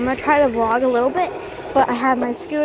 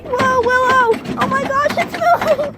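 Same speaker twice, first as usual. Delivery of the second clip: excited high calling; 13 semitones higher than usual. Real, not acted. Dog on the wobbly bus.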